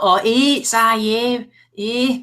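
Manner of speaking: mock Scouse accent. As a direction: caricature a Liverpool accent for a laugh. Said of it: "You know, like that".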